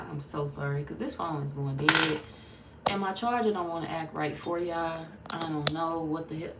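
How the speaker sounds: ordinary talk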